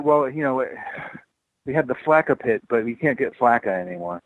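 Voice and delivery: normal talking voice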